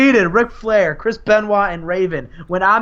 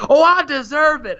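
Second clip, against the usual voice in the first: much higher, emphatic comeback; raised wrestler's retort; Diamond Dallas; 10 semitones higher than usual. Acted. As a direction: fire back in a mock-argument voice. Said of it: "Diamond Dallas is like".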